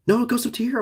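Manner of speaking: high excited correction. Real, not acted. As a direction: jump up in pitch, amazed. Corrects himself, excited pitch.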